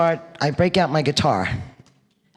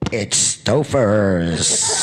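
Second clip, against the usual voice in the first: blunt deadpan verdict; 9 semitones lower, much slower than usual. Retold or imitated. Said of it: "Joy had no filter, and said".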